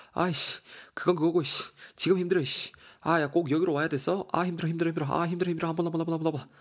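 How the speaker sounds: high, irritated moaning; whining, worn-out hiker. Acted. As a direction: grumble breathlessly through repeated complaints.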